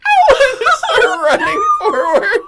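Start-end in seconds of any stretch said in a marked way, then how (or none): 0.1-0.4 huge high laughing yelp of pain; hammed-up dog yelp; imitated dog yelp. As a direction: yelp like a burned dog, cracking up. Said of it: "the dog go like".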